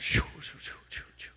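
repeated airy shoo sounds; imitated whoosh. Storyteller mimicking a plate coming down, imitated whooshing. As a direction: whoosh it down in short voiceless bursts.

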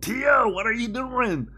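high, loud, drawn-out character call; Gert voice. Retold or imitated. Co-host recreates the silly voice caught off mic, high and loud Gert voice. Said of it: "doing that voice in random places".

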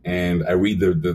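usual speaking voice

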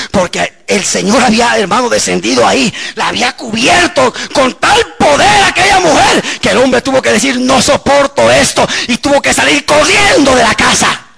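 climbing to a high preaching cry; full-force preaching. Acted. Preacher telling how power drove husband out, full-force preaching. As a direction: build the testimony to a triumphant climax.

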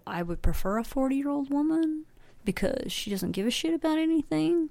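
light impression of a man explaining his taste; imitating such men. To the podcast guests, a light mock man's voice. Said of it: "men that go".

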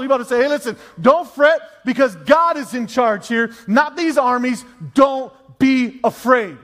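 rousing sermon delivery, hitting key words hard; preaching. Acted. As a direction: proclaim it to the congregation, loud and high. Preaches God's answer at full force.

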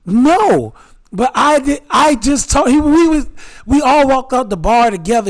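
animated denial to his friends; heated protest. Real, not acted. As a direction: push back hard, voice jumping up. Defending himself to teasing friends.

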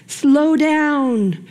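ordinary delivery

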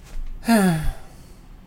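long falling sigh of giving up; resigned sigh. Shown as a demonstration. Showing the audience a resigned sigh. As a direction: let it all out, defeated. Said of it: "the resigned sigh is this".

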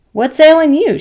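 high, loud insistent question; demanding. Acted. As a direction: demand an answer across the yard. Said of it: "she demanded".

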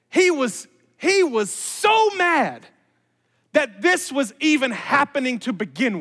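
loud, high, emphatic sermon delivery; impassioned preaching. Real, not acted. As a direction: preach it slowly and forcefully, punching key words. Preaches at full force.

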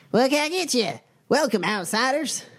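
high, bright-pitched tavern keeper greeting; bartender voice. Acted. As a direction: greet the strangers as a perky barkeep.

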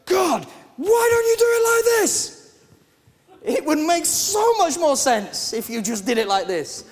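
hammed-up whiny protest at God; mock exasperated outburst; frustrated complaint to God. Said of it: "everything in me is just wanting to go".

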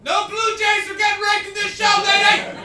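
high, very loud mock-indignant yell; shouting after someone. Real, not acted. Comedian to a woman leaving the show, high indignant shout. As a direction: holler it at her retreating back.

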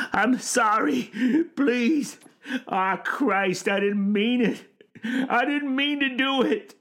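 high-pitched tearful pleading; old man wailing with remorse; sobbing. A narrator's reading. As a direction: beg forgiveness through sobs.

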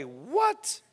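incredulous "what?", swooping up at the end; mock-stunned double take; acted disbelief. Hosea reacting to second order.

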